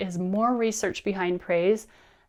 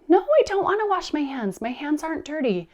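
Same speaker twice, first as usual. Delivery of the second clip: put-on high-pitched kid protest, loud at the start; whining child; 9 semitones higher, 4 dB louder than usual. Child refusing parent's order to wash hands, high whine. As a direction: mimic a stubborn little boy.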